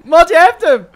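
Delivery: loud, high yell to a teammate; shouting. Real, not acted. Teammate urging Mat onto the ball, shouting. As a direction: shout it suddenly, pitched well up. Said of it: "niet schreeuwen".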